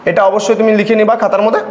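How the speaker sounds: ordinary talk, nothing marked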